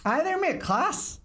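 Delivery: high, bright Irish greeting; chirpy Irish accent. Retold or imitated. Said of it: "He's fucking Irish".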